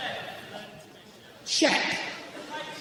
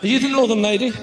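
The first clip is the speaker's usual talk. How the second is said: ordinary talk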